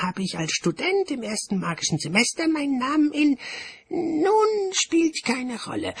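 very high, loud, excited character voice; high-pitched, exuberant official making a speech; cheerful bursar. A narrator's reading. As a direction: propose it gleefully in a high, piping voice. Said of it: "fuhr er fröhlich fort".

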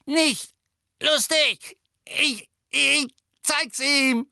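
high, strained, slow choked words. A narrator's reading. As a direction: spit the words out while being choked. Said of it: "würgte ihm ziemlich die Stimme ab".

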